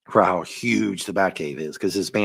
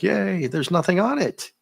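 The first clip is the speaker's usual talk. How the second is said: mock excitement; 11 semitones higher than usual. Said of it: "and I'm like".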